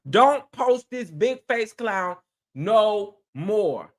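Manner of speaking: deliberately slow, word-by-word demand; slow emphatic repeat. Real, not acted. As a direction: spell it out slowly like a final warning.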